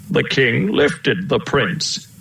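usual speaking voice

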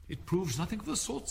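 put-on high girl's retort; contradicting Alice. A narrator's reading.

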